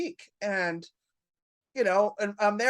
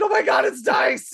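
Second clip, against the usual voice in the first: loud, higher starstruck shout; inner meltdown; 4 semitones higher, 7 dB louder than usual. Retold or imitated. Waitress seeing Dice walk in, inner meltdown. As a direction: yell it like a panicking fan. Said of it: "inside my head it's a meltdown".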